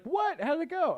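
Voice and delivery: very high-pitched, excited questioning; eager surprise. Retold or imitated. Friend hearing about an audition, excitedly surprised. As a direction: squeak upward in keen curiosity.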